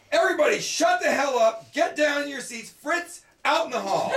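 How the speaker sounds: retold teacher yell. Teacher yelling at the rowdy class, mock shout. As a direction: yell it like an angry teacher clearing the room.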